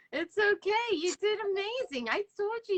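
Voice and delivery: high, teary laugh-cry. Real, not acted. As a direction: laugh and cry together in sympathy. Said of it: "I'm starting to cry".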